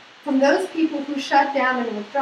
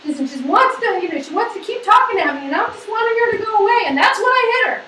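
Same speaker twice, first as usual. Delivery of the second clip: higher, louder agitated retelling voice; voicing a man who withdrew; 7 semitones higher, 5 dB louder, faster than usual. Husband explaining to therapist, agitated and defensive. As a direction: rush it out like a cornered husband. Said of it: "It's like".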